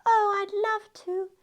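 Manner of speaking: high, soft, sorry-for-herself Cinderella; moping. Acted. Cinderella tells godmother she has no dress, moping softly. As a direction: mope in a small high voice. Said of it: "Cinderella moped".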